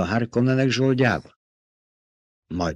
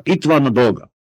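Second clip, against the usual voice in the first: sharp, raised, insistent statement; snapped retort; 5 semitones higher, 4 dB louder than usual. A narrator's reading. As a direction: cut off the objection angrily. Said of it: "csattant fel Jessica". (indulatosan felcsattanva)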